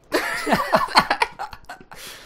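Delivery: airy high-pitched laugh; high breathy giggle. Real, not acted. Host laughing at the gif, high airy giggle. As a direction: let out a squeaky giggle.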